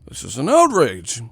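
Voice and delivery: hammed-up indignant complainer; mock outrage. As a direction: put on a scandalized voice. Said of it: "Y'all be".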